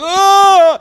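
very high, very loud scream of pain; agonized scream. Retold or imitated. Possessed king recoiling from the light, in a high loud scream. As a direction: shriek as the demon is driven out. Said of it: "this king starts going".

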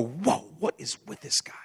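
hushed, whispered astonishment; amazed onlookers. Acted. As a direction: whisper it like a stunned bystander. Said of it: "to where people go".

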